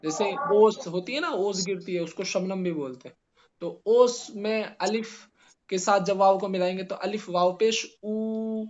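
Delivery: ordinary delivery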